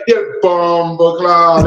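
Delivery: drawn-out Jamaican curse; slow, put-on Jamaican voice; imitated Jamaican accent. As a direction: stretch it out in a mock Jamaican accent. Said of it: "get around the Jamaicans, you really gonna be talking that".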